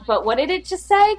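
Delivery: straining to catch a muffled line; confused rider. Retold or imitated. Mocking riders who can't hear over crowds, high and puzzled. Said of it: "it's like".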